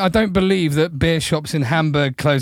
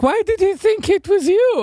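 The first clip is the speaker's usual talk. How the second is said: woman's voice; 3 dB louder than usual. Retold or imitated. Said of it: "she was like".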